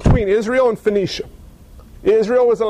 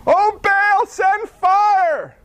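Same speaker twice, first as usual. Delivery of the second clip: much higher, wailing plea to Baal; frantic cry; 11 semitones higher, much slower than usual. Retold or imitated. A prophet of Baal screaming for fire from heaven. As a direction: shriek it like a crazed worshipper.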